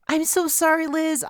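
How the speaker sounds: high, sobbing, broken apology. Acted. Chloe to her captain, sobbing. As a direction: cry out the sorry through tears. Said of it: "she said, sobbing".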